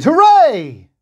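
very high, loud cheer; joyful shout. A narrator's reading. The freed servant cheering as he leaps up. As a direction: leap up and cheer. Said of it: "leapt to his feet and shouted".

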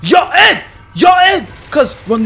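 loud high shout across the map; loud hailing call. Real, not acted. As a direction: yell to get his attention. Calling out to character.

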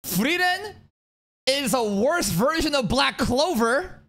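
high, slow silly troll voice; mock hot take. Parodying a clueless commenter in a squeaky voice. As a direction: ham up a dumb hot take. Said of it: "rather than just saying".

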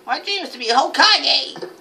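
high, loud mock-heroic ninja cry; put-on shinobi voice. Gamer playing a ninja, put-on shinobi voice. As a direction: declare it as an over-the-top young ninja. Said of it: "Can't do that voice no more".

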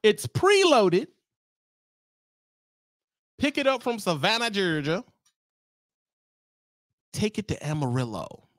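high dispatch readout; load message. Retold or imitated. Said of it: "gonna say something like this".

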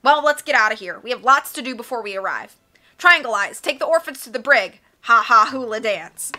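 loud, gloating commands; villainous orders. A narrator's reading. Olaf ordering the orphans to the brig, loud villainous commands. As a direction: boss your henchmen about.

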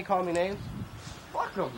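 ordinary talk

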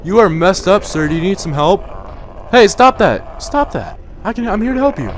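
loud, high scolding of an attacker; shouting. Real, not acted. Player scolds an attacking inmate, loud high shout. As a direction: yell at him to stop it.

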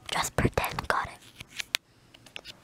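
unvoiced whisper aside. Real, not acted. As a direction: whisper it under your breath.

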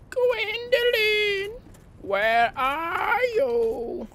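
very high, drawn-out falsetto call; playful high-pitched searching call; singsong calling. Pretend. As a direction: stretch the name out in squeaky falsetto.

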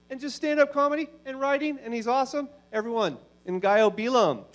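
high, projected host's introduction; emcee introduction. Real, not acted. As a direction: build up to the act's name for applause. Host bringing the headliner on stage.

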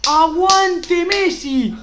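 high, loud, drawn-out chant; rallying shout. Real, not acted. Streamer chants for luck before opening a pack, rallying shout. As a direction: belt it out slowly like a terrace chant.

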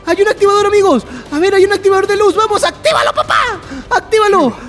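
frantic cheering to activate the light; urgent. Real, not acted. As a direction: shout at partner to hit the switch. Urging partner to hit switch.

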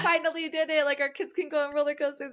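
high-pitched retold parental glee; complete excitement. Parents celebrating kids riding roller coasters, excited. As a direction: whoop it up like thrilled parents. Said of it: "from complete excitement".

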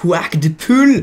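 « swag de poule » crié lentement, plus fort et plus aigu; détache chaque mot de « swag de poule » en criant lentement; martelé fort et lent. Shown as a demonstration. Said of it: "Vous voulez voir le swag de poule?". (il répète sa question en la martelant, fort et lent)

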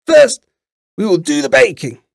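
put-on loud, high old-woman voice; old witch. A narrator's reading. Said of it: "said the old woman".

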